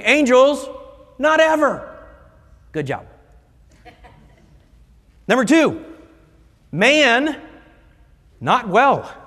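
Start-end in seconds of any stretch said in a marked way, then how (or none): 0.0-2.0 high, loud chanted refrain; call and response. Shown as a demonstration. Preacher leading the kids in chanting it back. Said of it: "Kids, say it with me". 5.3-9.1 high, loud declaration; proclaimed point. Shown as a demonstration. Preacher announcing his second point, high and loud.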